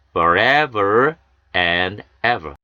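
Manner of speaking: forever and ever drawn out slowly; very slow model reading. Shown as a demonstration. Teacher modelling forever and ever slowly. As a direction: stretch it out for learners. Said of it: "함께 하시겠습니다".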